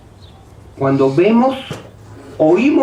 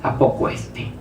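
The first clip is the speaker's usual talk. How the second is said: low, muttered mock disbelief; imitated doubter; 7 semitones lower, 6 dB quieter than usual. Preacher voices a listener doubting the promise, sceptical. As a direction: think aloud sceptically, pitched well down. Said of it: "usted empieza a pensar y dice".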